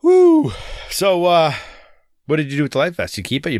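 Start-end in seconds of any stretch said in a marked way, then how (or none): 0.0-0.5 excited whoop. Real, not acted. Sudden cheer.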